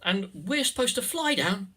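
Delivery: quick, high Irish lilt; chirpy Irish little person; Irish accent. Pretend. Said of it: "forgive the Irish".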